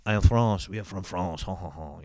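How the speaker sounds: hammed-up French accent with a mock chuckle; exaggerated French accent, loud start then dropping. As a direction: play a cartoon French person. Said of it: "like that".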